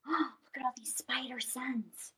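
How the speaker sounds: breathy aside whisper; hushed storytime aside. Acted. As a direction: lean in and whisper it to the kids.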